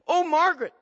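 high, pleading call; the soldiers plead with Margaret, high and pleading. Retold or imitated. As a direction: beg her to give in. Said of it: "pleaded some".

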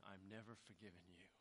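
soft, low bitter murmur; older brother vowing quietly never to forgive. Acted. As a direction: say it under your breath, unforgiving. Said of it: "you can almost hear him saying".

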